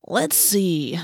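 drawn-out musing; mock pondering. A narrator's reading. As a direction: pretend to weigh it up. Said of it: "Marco considered".

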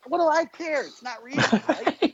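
high, airy who-cares; carefree shrug. Retold or imitated. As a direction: toss it off lightly. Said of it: "part of me was like".

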